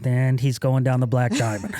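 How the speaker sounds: normal talking voice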